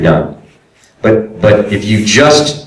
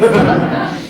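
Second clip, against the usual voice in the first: high airy laugh; 11 semitones higher, 5 dB quieter than usual. Real, not acted. Laughs after his own joke.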